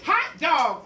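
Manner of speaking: put-on a kids' shout of joy; cheering class. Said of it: "cried the class".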